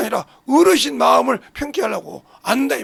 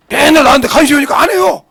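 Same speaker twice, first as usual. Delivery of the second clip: loud, pressing exclamation; raised preaching voice mid-story; forceful shout; 4 semitones higher, 9 dB louder than usual. Real, not acted. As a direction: burst out loudly to make the point. Preacher exclaiming while telling story.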